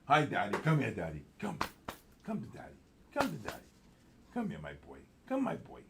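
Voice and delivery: soft, mostly low coaxing; tender, hushed talk to a dog; coaxing a pet. Real, not acted. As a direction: call the dog over gently in short phrases. Calling his dog over to him.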